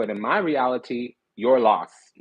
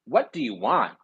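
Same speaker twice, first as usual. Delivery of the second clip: usual speaking voice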